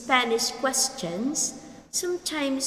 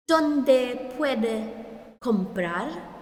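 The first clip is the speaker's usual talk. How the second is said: slow repeat; much slower than usual. Shown as a demonstration.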